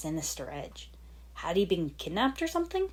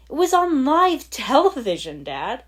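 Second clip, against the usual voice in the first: higher and louder, cutting tone; biting; 8 semitones higher, 10 dB louder than usual. Acted. Lloyd snapping at his father, biting. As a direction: spit the fact at him. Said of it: "he said bitingly".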